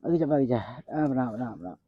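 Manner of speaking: soft, low chant fading away; quiet muttered prayer. Acted. Muttering a prayer under his breath. (ਹੌਲੀ ਹੌਲੀ ਰਾਮ ਰਾਮ ਜਪਣਾ)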